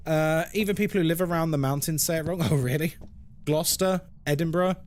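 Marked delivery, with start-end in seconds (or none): none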